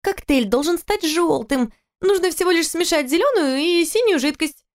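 put-on a drunk young woman insisting; drunk girl voice. Drunk girl's high voice.

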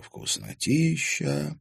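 put-on boy tasting a pie, blissful. A narrator's reading. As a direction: savour the word with pleasure. Блаженно зажмурился.

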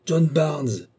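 a commanding voice summoning him; calling from above; echoing call. Acted. The voice calls again louder.

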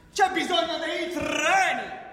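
booming slow political shout; mock rally shout. Satirist mocking politicians with a rally shout. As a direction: drag the words out like a rally climax.

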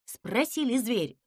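ordinary delivery, nothing marked

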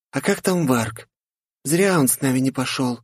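put-on high, slightly regretful girl; wistful young girl. Gloria continues. (высокий девичий голос)

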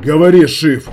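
loud, harsh order; commanding. A narrator's reading. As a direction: order her to speak. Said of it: "приказал".

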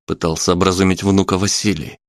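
ordinary delivery, nothing marked

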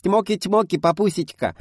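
put-on very high, squeaky child voice; squeaky little girl. A narrator's reading. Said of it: "запищала девочка".

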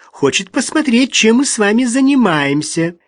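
high, sweet cooing voice. A narrator's reading. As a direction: keep up the sugary coo. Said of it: "проворковала Рут".

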